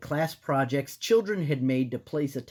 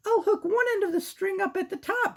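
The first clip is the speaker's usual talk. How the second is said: put-on squeaky girl mouse; high-pitched cartoon mouse; 3 dB louder than usual. Voicing Sarah.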